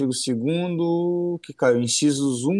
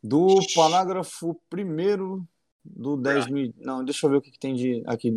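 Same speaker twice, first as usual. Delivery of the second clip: ordinary delivery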